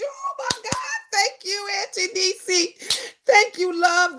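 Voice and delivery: high, hushed, choked-up thanks; moved near tears. Real, not acted. Host thanking a viewer who texted her sister, teary. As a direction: voice lifting and breaking with emotion. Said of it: "you gonna make me cry".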